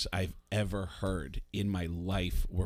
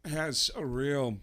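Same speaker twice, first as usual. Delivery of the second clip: halting stop-and-go delivery, slightly higher; Christopher Walken; 4 semitones higher than usual. Acted. Comedian showing a stop-and-go kind of voice, halting impression. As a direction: break it up with odd, sudden pauses. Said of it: "Like, Christopher Walken".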